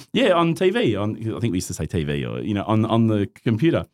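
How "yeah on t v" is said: excited fan. Retold or imitated. Fan recognizing him in mosque.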